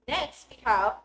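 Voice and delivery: ordinary talk, nothing marked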